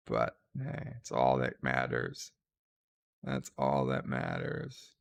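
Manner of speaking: mock deep voice. Mock triumphant line after dying.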